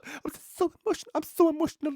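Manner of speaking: high mock-tearful falsetto; mock emotional woman. Mocking the advert mother's weepy emotional announcement. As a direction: overplay the emotional advert mum. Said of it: "You know".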